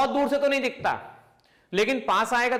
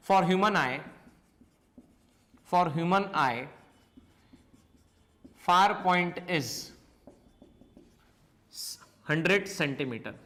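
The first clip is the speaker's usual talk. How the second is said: slow, spaced-out dictation with long pauses; 4 semitones lower, 3 dB quieter, much slower than usual. Acted. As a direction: read it out slowly so students can write every word. Dictating notes to the class.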